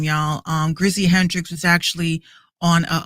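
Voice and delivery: usual speaking voice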